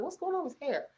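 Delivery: lightly voiced wonder at the haircut; puzzled. Seeing the boy's hair, puzzled. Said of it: "I'm like".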